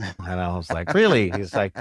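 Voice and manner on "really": surprised disbelief. Retold or imitated. Kid surprised by surgeon's reveal.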